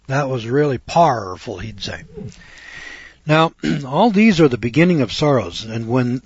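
ordinary talk, nothing marked

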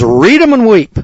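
the card dealer calling it out high and loud; loud, high dealer announcement; dealer's call. Retold or imitated. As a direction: announce it like a dealer slapping down the cards. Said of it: "he says".

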